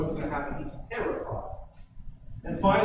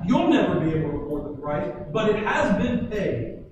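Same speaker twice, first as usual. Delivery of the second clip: louder, higher, slowing on the key words; full-force preaching; 3 semitones higher, 6 dB louder than usual. Acted. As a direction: preach it out, building to a climax.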